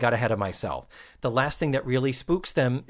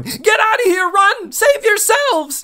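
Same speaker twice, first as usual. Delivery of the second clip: much higher, loud warning yell, slow on the last words; panicked shout; 8 dB louder than usual. Retold or imitated. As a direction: scream at the kids to flee. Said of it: "is now the one going".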